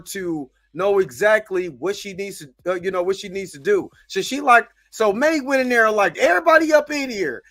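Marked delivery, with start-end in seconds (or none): 6.2-7.4 higher, louder commanding call; hammed-up order to the room; mock command. May walking in on everyone, loud mock command.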